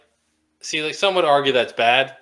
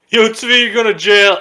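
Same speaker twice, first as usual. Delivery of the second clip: loud teasing shout of a big brother; mock taunting yell; 8 semitones higher, 9 dB louder than usual. Big brother yelling at his little brother that he's going to jail, loud mock taunt.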